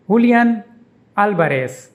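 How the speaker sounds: Julian as hoo-lee-AHN, Alvarez as AL-ba-res; Spanish pronunciation. Shown as a demonstration. Teacher demonstrates the footballer's name, saying it the Spanish way. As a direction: Julian with an h sound and stress on the last syllable, Alvarez with a b for v and an s ending. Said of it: "is pronounced".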